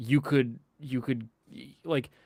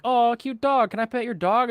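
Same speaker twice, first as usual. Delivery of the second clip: mock gushing friend; 10 semitones higher, 3 dB louder than usual. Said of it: "his friend from school comes up".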